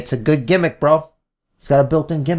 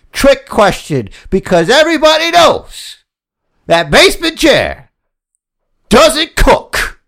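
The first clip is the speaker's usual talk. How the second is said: loud, higher promo voice; wrestling promo; 8 semitones higher, 3 dB louder than usual. Acted. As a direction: hype it like a wrestler. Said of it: "like I'm doing a wrestling promo".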